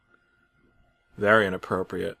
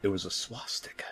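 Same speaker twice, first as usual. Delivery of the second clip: whispered reveal; horrified whisper; 11 dB quieter than usual. A narrator's reading. Narrator seeing what Bob built, in a horrified whisper. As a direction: breathe it out in dread.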